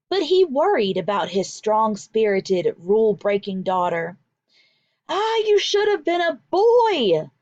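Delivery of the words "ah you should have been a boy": father's regretful sigh. A narrator's reading. Father to his rule-breaking daughter, high fond regret. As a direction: exclaim it like a proud but worried father.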